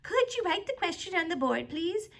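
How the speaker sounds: high, put-on mimicking voice; mocking voice. A narrator's reading. Teacher mimicking a pupil's polite request, mocking. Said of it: "In a mocking voice".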